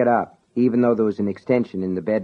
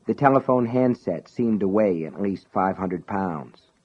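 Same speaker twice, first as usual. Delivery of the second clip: usual speaking voice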